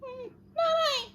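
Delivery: very high tiny mouse voice; squeaky mouse. Acted. Voicing the mouse.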